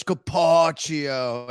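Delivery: put-on slow, stretched Italian accent; bad Italian accent. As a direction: draw out the name Italian-style. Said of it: "He goes".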